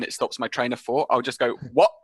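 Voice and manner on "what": sharp short what. Shown as a demonstration. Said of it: "a very, like, sharp, short word".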